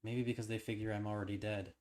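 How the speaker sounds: steady, even storytelling read; story narration. A narrator's reading. Reading the game's story aloud to viewers, steady narration.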